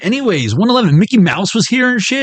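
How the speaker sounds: ordinary talk, nothing marked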